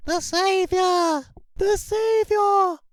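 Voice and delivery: high, slow chanting alien cries; alien crowd cries. Acted. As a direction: call out in worship, high and drawn out. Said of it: "cries of".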